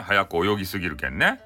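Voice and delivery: ordinary delivery, nothing marked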